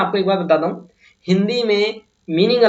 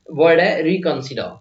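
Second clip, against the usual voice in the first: ordinary talk, nothing marked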